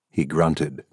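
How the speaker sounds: usual speaking voice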